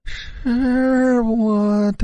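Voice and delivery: high, very slow ghost voice; ghostly woman. A narrator's reading. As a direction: draw it out, cold and hostile. Female ghost answers.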